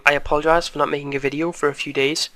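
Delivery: normal talking voice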